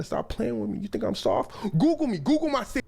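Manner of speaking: high mock-indignant voice, rising as he goes. Storyteller as the singer challenging his people, climbing mock outrage. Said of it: "[name] voice can get pretty deep".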